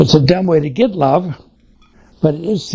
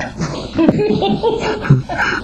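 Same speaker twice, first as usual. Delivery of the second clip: warm laugh. Real, not acted. Laughs at his own story.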